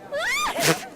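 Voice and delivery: a short, very high excited squeal. Acted. Mai hears Jontron walked her friend, high squeal. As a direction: squeal like a fangirl hearing big news. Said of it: "Mai emitted a high pr-, pressurized squeal".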